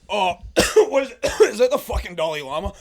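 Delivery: high, rushed words broken by coughs; coughing fit. Pretend. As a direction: cough your way through the question. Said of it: "Are you coughing and going".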